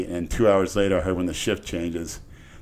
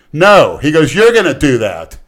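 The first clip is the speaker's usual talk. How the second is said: loud, higher flat refusal as the cop; 6 semitones higher, 10 dB louder than usual. Retold or imitated. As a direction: bark the cop's no at him.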